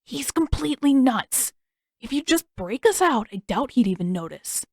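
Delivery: ordinary delivery, nothing marked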